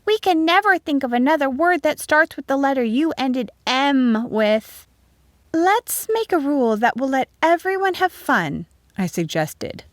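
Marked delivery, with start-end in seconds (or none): 0.0-4.9 put-on high-pitched child's complaint; high, protesting pupil; complaining. A narrator's reading. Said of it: "Joanna complained". 5.5-8.7 high, encouraging schoolteacher voice; high-pitched teacher addressing pupils; teacher proposing. A narrator's reading. Teacher figure proposes a rule.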